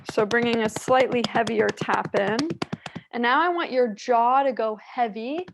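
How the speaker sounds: ordinary delivery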